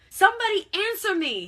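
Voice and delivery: high, very loud demand; frantic, high-pitched yell; enraged shout. A narrator's reading. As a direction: shout it out, furious and desperate for an answer. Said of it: "with a bit of spittle foaming".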